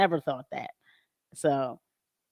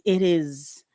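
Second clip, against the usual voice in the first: ordinary delivery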